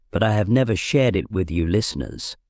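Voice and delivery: ordinary talk, nothing marked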